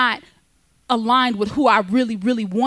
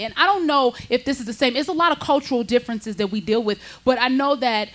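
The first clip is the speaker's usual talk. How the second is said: usual speaking voice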